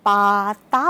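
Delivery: পাতা stretched long and loud, syllable by syllable; drawn-out, sing-song demo. Shown as a demonstration. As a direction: say পাতা slowly, holding each vowel as if teaching a child. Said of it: "সুরে সুরে বাচ্চাটাকে বলানোর চেষ্টা করি".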